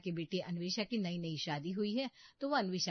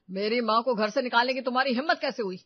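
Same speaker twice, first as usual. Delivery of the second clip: loud, raised angry demand; indignant outburst; 4 semitones higher, 10 dB louder, faster than usual. Acted. Husband confronts his wife for evicting his mother, indignant outburst. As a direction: confront her at full volume.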